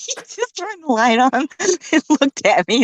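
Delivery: words broken by laughter, high; laughing. Real, not acted. Mom laughing about her son checking on her. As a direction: giggle through the line.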